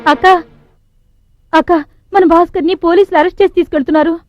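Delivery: high, loud urgent call. Acted. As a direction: rush in with the bad news. Boy runs in with news.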